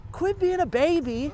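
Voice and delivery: high mocking tease; teasing. Real, not acted. Teasing dad, higher voice.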